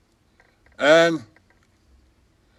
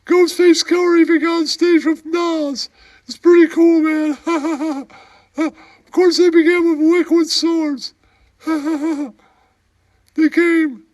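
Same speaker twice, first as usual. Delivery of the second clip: high, squeaky put-on character voice, with giggles; squeaky comic voice; 12 semitones higher, 4 dB louder than usual. Comic sidekick telling a bar story in a squeaky giggling voice. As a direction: play a giggling oddball sidekick.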